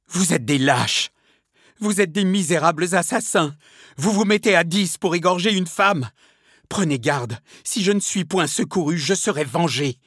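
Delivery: high, loud accusing outburst; acted indignant exclamation; outraged cry. A narrator's reading. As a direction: cry out in furious defiance at your captors. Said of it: "elle rompit le silence pour s'écrier".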